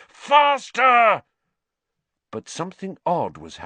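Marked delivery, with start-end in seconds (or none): 0.1-1.2 loud, high urgent shout. A narrator's reading. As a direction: yell at the broom to go faster. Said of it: "he growled at his nimbus".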